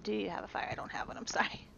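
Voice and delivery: soft unvoiced whisper. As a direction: whisper it like a secret. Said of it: "I said".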